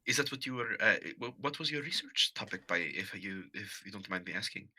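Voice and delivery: mildly awkward, apologetic curiosity; tentative politeness. Acted. Awkward character making small talk.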